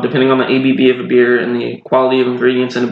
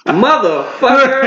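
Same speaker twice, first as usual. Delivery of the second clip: mock tough guy; 12 semitones higher, slower than usual. Mock outraged cop bit.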